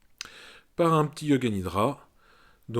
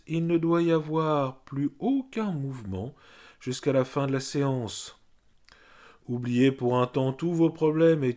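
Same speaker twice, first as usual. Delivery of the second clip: measured, sing-song meditation guidance; guided yoga nidra; 3 semitones higher, slower than usual. Real, not acted. Yoga teacher settling listeners on the floor, guided meditation voice.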